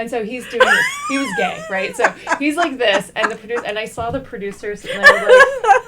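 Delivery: loud and high, laughing through the words; cracking up mid-story. Real, not acted. Retelling the set story, loud laughing.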